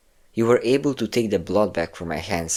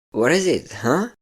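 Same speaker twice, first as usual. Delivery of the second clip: higher, singsong mock concern; mocking taunt; 9 semitones higher, 4 dB louder than usual. Captor mocks the captive's reaction, higher mocking singsong. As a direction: needle them with fake sympathy.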